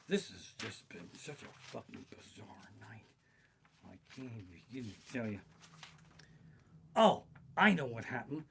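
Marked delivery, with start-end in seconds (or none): 0.1-5.4 soft unvoiced whisper. Real, not acted. Confiding to audience in a whisper. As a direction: hush it to a whisper.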